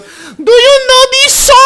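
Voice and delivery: high, slow Spanish accent imitation. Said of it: "she has a super thick Spanish accent".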